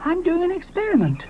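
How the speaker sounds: high, self-important; proud Moschops. Acted. Moschops explains himself to Ally, high self-important voice. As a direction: announce it grandly.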